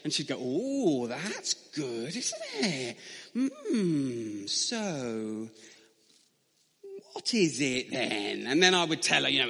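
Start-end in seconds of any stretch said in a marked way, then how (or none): 0.4-8.5 slow, gently coaxing, a lift on isn't it; patient mum voice. Retold or imitated. Mother studying her son's messy painting, slow coaxing praise. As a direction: humour the child kindly, drawing it out.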